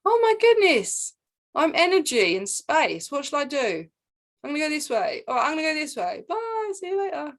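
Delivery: high sing-song creator voice; playful creator voice. Retold or imitated. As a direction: play the newborn creator, delighted. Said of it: "beautiful creator going".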